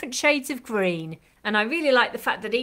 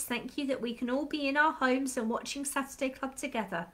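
a soft, steady prayer voice; children's prayer; 5 dB quieter than usual. Acted. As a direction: pray gently with the children.

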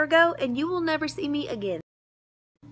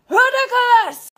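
slow high furious shout; exasperated yell; 11 semitones higher, 11 dB louder than usual. Acted. Outburst in sketch.

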